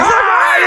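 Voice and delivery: sudden high mock shout; imitated cry. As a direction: let out a startled-sounding high "Ah". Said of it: "he does one of these".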